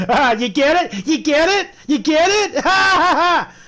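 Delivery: high, loud, cackling "you get it?"; mock the prankster, laughing wildly; imitating hysterical laughing guy. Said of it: "and he goes".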